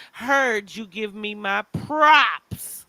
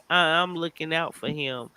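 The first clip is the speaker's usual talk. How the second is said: ordinary talk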